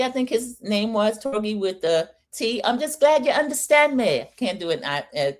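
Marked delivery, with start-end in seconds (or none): 2.6-4.3 put-on a botched, higher try at a Scottish accent; failed Scottish accent. Host tries to voice Torgi the Scotsman: failed Scottish accent. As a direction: attempt a Scottish accent and fall short. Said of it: "I can't do a Scottish accent".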